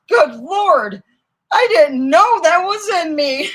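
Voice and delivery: high, loud shocked outburst; startled exclamation. Retold or imitated. Friend caught by the prank, loud shocked blurt.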